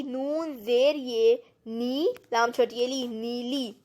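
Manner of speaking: نون زیر یہ نی, each letter sounded out very slowly; slow spelling out. Shown as a demonstration. Teacher slowly spelling a word aloud for a pupil. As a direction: spell it out syllable by syllable for a child. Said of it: "نون کے اوپر کیا لگے گی؟".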